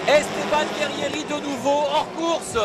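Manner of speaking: excited commentator. Real, not acted. Live race crash commentary.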